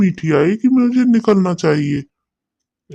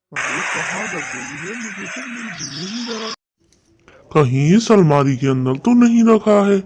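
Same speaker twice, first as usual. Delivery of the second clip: a lightly voiced sly crow, plotting; a hint of a sly crow talking to himself; scheming crow; 3 semitones higher than usual. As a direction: mutter like a crafty crow planning a theft. Crow searches the cupboard.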